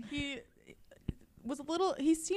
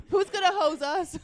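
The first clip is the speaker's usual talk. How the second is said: laughing; 8 semitones higher, 5 dB louder than usual. Real, not acted. Laughing at gross joke.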